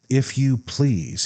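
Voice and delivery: emphatic, slowed-down refusal. A narrator's reading. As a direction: cut her off with weighty emphasis. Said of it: "he said emphatically".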